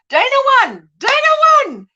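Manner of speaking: high, loud, delighted news; excited announcement. Real, not acted. Telling the viewers who won the bet, overjoyed. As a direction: burst out with the result, thrilled. Thrilled news of the wager result.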